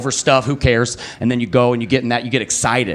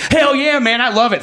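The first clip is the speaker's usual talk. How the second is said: mock-excited postal clerk, pitched way up; 11 semitones higher than usual. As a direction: fire it off like a stoked clerk. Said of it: "He was so excited".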